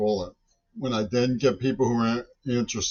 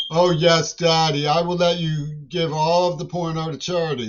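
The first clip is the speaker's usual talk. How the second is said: higher, louder mock-obedient plea; sarcastically imitated submission, pitched up; mock compliant child; 6 semitones higher, 3 dB louder than usual. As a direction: put on an exaggerated yes-Daddy voice. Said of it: "they want me to say".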